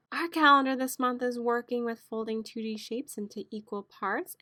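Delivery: ordinary talk, nothing marked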